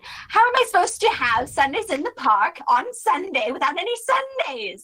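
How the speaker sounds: high, fast whining complaint; high rushed whine; shrill Celia. Acted. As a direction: rush it out high and aggrieved. Celia escalates her complaint.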